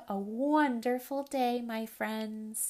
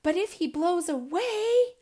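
high, loud anxious owlet; worried cry; 8 semitones higher, 7 dB louder than usual. Acted. Owlet fears for small sibling, crying out. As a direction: cry out in alarm. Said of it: "cried two".

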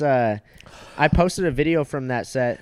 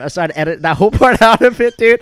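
laughing; 10 semitones higher, 11 dB louder than usual. Real, not acted. Laughs through the line.

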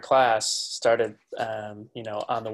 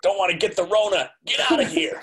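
put-on higher cranky old man voice; grumpy landowner; 8 semitones higher than usual. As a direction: shoo everyone off your property. Continuing the porch bit.

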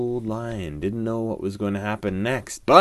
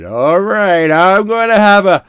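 loud, higher fake-sleepy lion; old lion voice; 9 semitones higher, 15 dB louder than usual. A narrator's reading. Lion pretends to sleep to catch the monkey, loud. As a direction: announce a nap loudly to trick the monkey. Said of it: "went and said".

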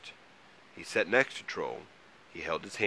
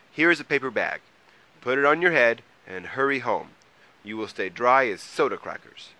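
higher, louder character voice; helpful Mole; 5 semitones higher, 8 dB louder than usual. A narrator's reading. Mole offers Troll a paper bag, helpful. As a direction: hand over the idea briskly and firmly.

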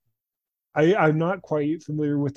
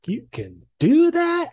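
much higher, slow, astonished; retelling his stunned reaction as a teen; amazed disbelief; 5 dB louder, slower than usual. Retold or imitated. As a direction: squeak out the question in shock. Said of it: "And I'm just like".

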